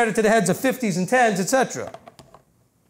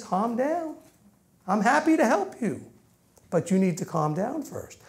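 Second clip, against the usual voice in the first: calm reassurance; 3 semitones higher, 5 dB quieter than usual. Acted. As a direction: soothe them gently, like a patient father. Voices Father soothing his children.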